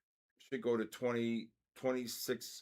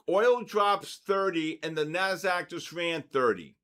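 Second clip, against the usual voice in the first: higher, louder excited burst; excited market call; 7 semitones higher, 8 dB louder than usual. Real, not acted. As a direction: call out the sudden move, loud and high. Live trader reacts to price jump.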